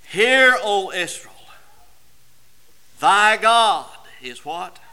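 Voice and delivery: fervent pulpit voice, slow and loud; preaching. Acted. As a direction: hammer each phrase home to the congregation. Preaching climax.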